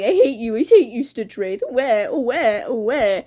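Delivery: mock crybaby whine; put-on childish tantrum; Blood Moon. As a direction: wail it like a fake sulky baby. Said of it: "Blood Moon was like".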